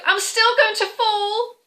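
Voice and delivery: high, loud playful wail; mock fear. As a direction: wail it in play fright. Welsh cake fears falling.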